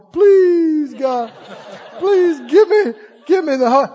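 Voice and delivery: high, loud drawn-out begging; hollered pleading. Retold or imitated. Wilted seeker still begging at the altar, hollered pleading. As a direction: imitate a desperate holler to God, played for laughs. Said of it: "I'm still going".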